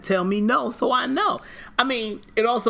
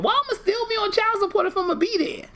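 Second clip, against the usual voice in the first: much higher, indignant squeak of disbelief; mock outrage. Joking with her about marriage terms, high mock outrage.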